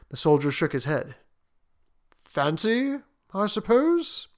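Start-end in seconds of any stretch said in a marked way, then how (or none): 2.2-4.3 hesitant, drawn out, pitched up; slowly. Acted. Soldier considering why he keeps the paw, slowly. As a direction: ponder each word, voice lifted. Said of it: "he said slowly".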